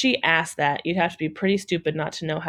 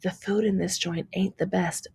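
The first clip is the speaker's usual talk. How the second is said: softer, confiding stage whisper; mock whisper; 6 dB quieter than usual. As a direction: pretend to let him in on a secret. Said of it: "He acted like he was whispering".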